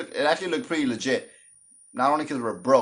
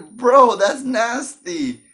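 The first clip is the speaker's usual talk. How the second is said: grossed-out disbelief; 10 semitones higher, 8 dB louder, much slower than usual. Pretend. Listener imagined reacting to drinking.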